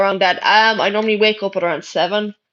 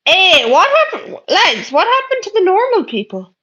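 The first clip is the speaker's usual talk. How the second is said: exasperated disbelief; 8 semitones higher than usual. Real, not acted. Disgusted at the viewers' messages.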